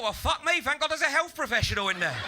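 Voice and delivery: loud, high sarcastic gratitude; mock relief. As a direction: exclaim it with exaggerated sarcastic relief. Sarcastic thought about the dealer's advice.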